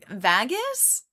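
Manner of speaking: rising VAGUS with a short A; short A, as in bag, in VAGUS; imitated odd Vegas. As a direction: query VAGUS as VAG-us. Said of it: "people say Vagus instead of Vegas".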